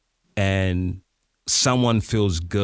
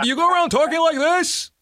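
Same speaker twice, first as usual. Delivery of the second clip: much higher, fast, hammed-up announcer; mock radio voice; 3 dB louder, faster than usual. Shown as a demonstration. Coach teasing a student, still in mock announcer voice. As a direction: keep mocking in that booming voice. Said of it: "I said".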